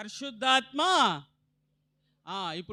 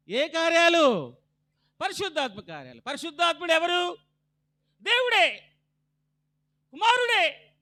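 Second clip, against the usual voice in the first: high, loud preaching cry; full-force sermon; fiery preaching; 9 semitones higher, 7 dB louder than usual. Acted. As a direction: lift the voice high and loud to proclaim.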